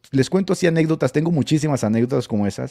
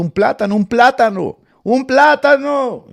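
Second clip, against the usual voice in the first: rising mock yell, higher and louder each time; imitated yelling prophet; 11 semitones higher, 8 dB louder, slower than usual. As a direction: ham up a prophet screaming from the pulpit, building. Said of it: "Gritando en el púlpito". (imitando al profeta que grita cada vez más fuerte y agudo)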